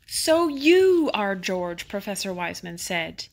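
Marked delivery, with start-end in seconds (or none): 0.1-1.9 slow, loud and high discovery; surprised realization. Acted. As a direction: draw it out in amazement. Professor meets the famous monkey.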